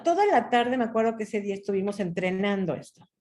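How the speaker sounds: ordinary delivery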